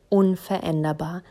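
plain reading voice

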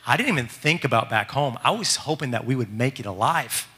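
plain reading voice

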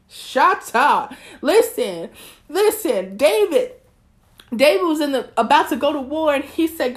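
high, loud, fiery preacher voice; preaching at full force. Acted. Podcast host preaches to her listeners about pursuing God's plan, fiery and loud. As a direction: preach it out like Sunday morning.

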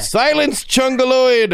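high, mock-grand command; commanding villain. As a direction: order the creature to be silent. Commanding a demon mock-dramatically.

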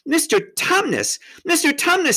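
urgent, high-pitched pleading call; greatly distressed. A narrator's reading. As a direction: call out in distress as a young girl. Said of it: "said Lucy in great distress".

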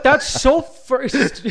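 high, slow laughing jab. Real, not acted. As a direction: tease it through laughter. Mocking a first-commenter, laughing.

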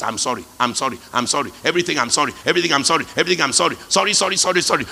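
fast, tumbling string of sorries; rapid sorries. Retold or imitated. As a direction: gush apologies nonstop. Apologising for everything.

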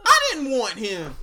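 high, loud rant edged with laughter; ranting at owner. Acted. Fan berates team owner over Paxton Lynch, exasperated rant. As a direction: let exasperation climb into a loud, high protest.

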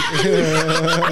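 high, loud burst of real laughter. Real, not acted. Laughing after the scare.

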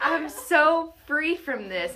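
high, loud, drawn-out proclamation through laughter; fired-up declaring. Retold or imitated. Teller replays her drive-home voice memo, declaring it jubilantly. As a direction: declare it exultantly, laughing at yourself. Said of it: "and just declaring that".

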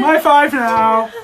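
high, loud, drawn-out creature cry; slow, squawking creature yell; creature's high-five voice. Acted. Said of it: "That's how they would say it".

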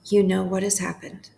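unhurried prayer with long pauses between phrases; guided prayer meditation. Acted. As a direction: pray it phrase by phrase, leaving room to repeat.

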